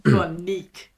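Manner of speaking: breathy London roadman slang; roadman accent. Pretend. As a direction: street roadman, half-whispered. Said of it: "How would you talk to me if, uh, if you, uh, were a roadman?".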